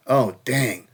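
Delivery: normal talking voice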